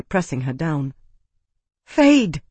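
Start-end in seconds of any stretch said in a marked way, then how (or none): 1.8-2.4 high, loud gasp; gasped cry. A narrator's reading. Isana crying out at Fade pinning her, loud. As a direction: cry his name in shock. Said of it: "she gasped".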